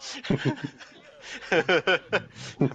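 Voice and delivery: high, loud burst of giggles; real laugh bubbling up loudly. Real, not acted. Reviewer cracking up at his own rant, laughing.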